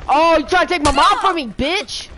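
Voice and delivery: high, loud mocking yell, last line slow; gloating taunt. Real, not acted. Gamer as Jason taunting a counselor, loud mocking yell. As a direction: crow at the victim.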